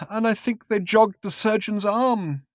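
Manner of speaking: high, broken drugged man; frail, drugged voice; drugged witness. Acted. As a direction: stammer it out dazed and weepy. Drugged man's held voice.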